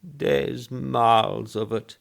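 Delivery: put-on high, slow quavering old man. Old man describing the cemetery, quavering. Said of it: "quavered old Woodfield".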